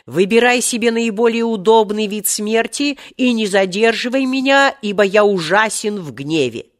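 angry genie's ultimatum; wrathful threat. A narrator's reading. Genie threatens his rescuer with death.

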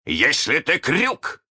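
a touch of meekness, drawn out; almost humble. A narrator's reading. Hook questions the voice, almost humbly. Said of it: "сказал он чуть ли не смиренно".

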